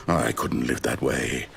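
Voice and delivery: low, slow hopeless murmur; despairing. Acted. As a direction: give up quietly. Narrator despairing over story.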